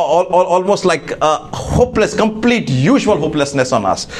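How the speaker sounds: ordinary talk, nothing marked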